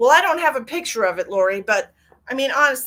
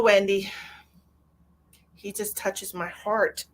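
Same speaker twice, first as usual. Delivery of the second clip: ordinary talk, nothing marked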